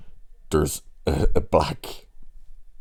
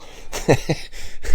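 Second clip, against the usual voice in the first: breathy laugh; 11 semitones higher than usual. Real, not acted. Laughs at own retelling.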